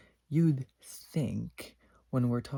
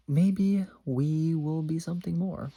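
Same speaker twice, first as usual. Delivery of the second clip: hopeful inner voice; 5 semitones higher, 5 dB louder, slower than usual. Retold or imitated.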